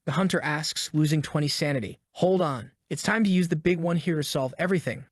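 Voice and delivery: usual speaking voice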